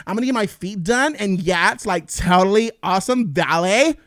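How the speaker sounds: mocking Valley girl, loud with upspeak; over-the-top imitated Valley-girl accent. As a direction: exaggerate the Valley-girl vowels and rises.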